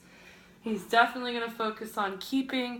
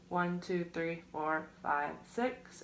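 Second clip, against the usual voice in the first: counting workout reps; 3 semitones lower, 4 dB quieter, slower than usual. Real, not acted. Coach counts held stretch.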